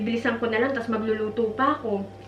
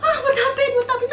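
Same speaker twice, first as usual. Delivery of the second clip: high, laughing mock-bewildered husband; Gunner's confused reaction; 13 semitones higher than usual. Wife mimicking Gunner's baffled reaction, laughing. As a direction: imitate a startled husband while laughing. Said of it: "reaction ni Gunner".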